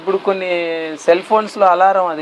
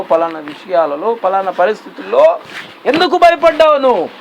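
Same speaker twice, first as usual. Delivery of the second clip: God questioning; 9 semitones higher than usual. Acted. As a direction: voice God pressing his fearful disciples. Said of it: "అని question వేస్తున్నాడు".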